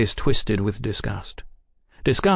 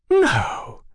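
very high, theatrical gasp of "no"; mock-shocked exclamation; feigned shock. A narrator's reading. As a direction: act scandalized, swooping down from high. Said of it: "Oliphant feigned shock".

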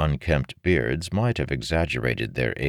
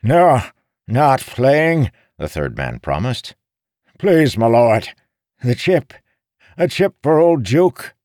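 high, loud, whining beggar plea; pleading old beggar; 11 semitones higher, 8 dB louder than usual. A narrator's reading. Old beggar pleading with Marin for the chip. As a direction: beg shamelessly for the coin.

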